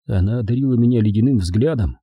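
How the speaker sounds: plain reading voice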